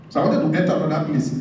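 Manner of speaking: usual speaking voice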